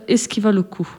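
ordinary delivery, nothing marked

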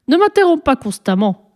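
annoyed pharaoh. A narrator's reading. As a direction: rebuke the child for interrupting. Pharaoh annoyed at being interrupted. (pharaon agacé, réprimande plus aiguë)

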